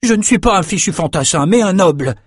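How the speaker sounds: high-pitched, emphatic protest; indignant young noble. A narrator's reading. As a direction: protest your rank with wounded pride. Brock's indignant protest continues.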